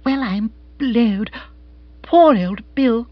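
marvel, then pity with drawn-out words; astonished pity. Acted. Monica astonished, then pitying her brother.

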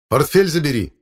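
high, loud order; shouted after him. A narrator's reading. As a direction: call sharply after someone walking away. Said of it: "крикнул я ему вслед".

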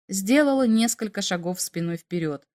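plain reading voice

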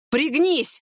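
high-pitched, contained narrated yell; urgent warning cry without real volume; wild yell. A narrator's reading. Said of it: "диким голосом закричал Виталий". (сдержанный крик тревоги)

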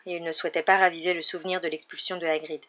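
ordinary delivery, nothing marked

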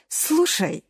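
high, annoyed call; irritated. Acted. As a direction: snap for attention, irritated. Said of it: "раздражённо сказал Эразм". (раздражённо)

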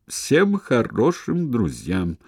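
slow, loaded "всем хорошим друзьям"; pointed emphasis. A narrator's reading. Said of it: "он подчеркнул особой интонацией".

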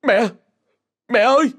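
desperate shout for mother; panicked cry. Acted. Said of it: "cổ họng nghẹn cứng".

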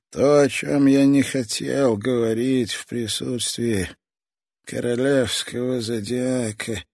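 put-on high, slow, sinister old toymaker; a menacing old man savouring every word; creepy old man voice. Old toy master speaks.

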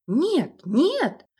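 high, loud desperate no; furious shout. A narrator's reading. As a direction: cut him off fiercely. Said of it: "яростно перебил отца".

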